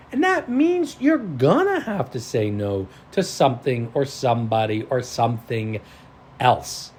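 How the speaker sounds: ordinary delivery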